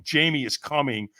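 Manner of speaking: ordinary delivery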